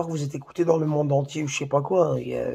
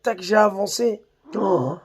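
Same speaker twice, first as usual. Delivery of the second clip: ordinary talk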